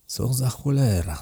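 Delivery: bewildered private curse; inward curse. A narrator's reading. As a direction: swear to yourself, drawing it out. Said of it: "zaklął w myśli".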